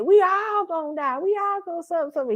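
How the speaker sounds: high mock preacher voice. Mocking the church preacher's warning, pitched high. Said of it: "the preacher said".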